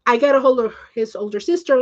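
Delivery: ordinary delivery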